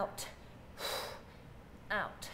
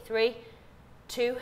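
ordinary talk, nothing marked

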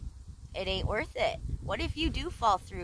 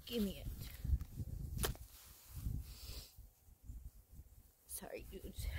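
slow, much softer whispered apology; dejected whisper; 2 semitones lower, 13 dB quieter, much slower than usual. Real, not acted. Whispered apology to viewers after the lost fish. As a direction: whisper it, deflated.

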